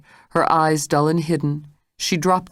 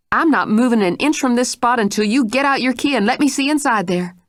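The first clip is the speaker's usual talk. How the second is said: higher, firm unyielding voice; fierce determination; 8 semitones higher, 3 dB louder than usual. Acted. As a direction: refuse to budge. Mrs Stitt with fierce determination.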